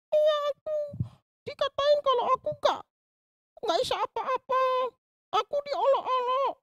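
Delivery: put-on very high child puppet voice, telling her woes; a small girl explaining why she is sad; Susan puppet voice. Puppet girl Susan tells the pastor what the other kids said, in a very high voice. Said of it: "ini namanya Susan".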